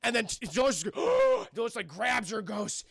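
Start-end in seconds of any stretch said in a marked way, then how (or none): 0.9-1.5 loud, sudden revival gasp; sharp mock gasp. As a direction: suck in air as if jolted alive. Imitates dying Dolores reviving.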